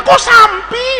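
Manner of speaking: high, baffled correction; startled teacher. Acted. Shocked teacher correcting a pupil, high startled voice. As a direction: jump up in pitch, taken aback. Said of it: "gurunya kaget".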